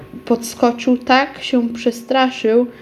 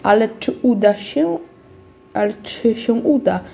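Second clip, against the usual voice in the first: plain reading voice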